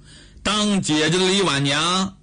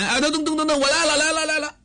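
high, rushed calling back from the courtyard; hurried eager reply; 9 semitones higher, much faster than usual. Acted. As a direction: answer your wife's call in a hurry. Husband answers wife's call.

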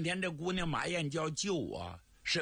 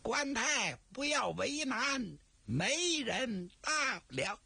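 high, slow, drawn-out teasing voice; sly teasing old man; 10 semitones higher, slower than usual. Acted. Zhao Bi teases Guan Tai from behind the screen, slow high sly voice. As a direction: tease him slowly, savoring it.

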